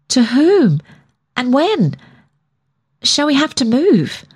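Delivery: worried rush of questions; alarmed questions. A narrator's reading.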